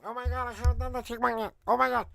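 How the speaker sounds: high, fast squeaky chipmunk voice. Real, not acted. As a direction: talk like a sped-up chipmunk. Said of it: "I got a chick monkey in my mouth".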